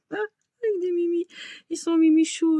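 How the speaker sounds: high delighted coo. Real, not acted. Gushing over cute clips.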